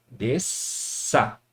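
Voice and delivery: "desça" cut as des, pause, ça; split into syllables. Shown as a demonstration. Teacher repeating the word's division, syllable by syllable. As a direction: hold the S, pause, then give the last syllable. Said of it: "Vai ficar dessa forma".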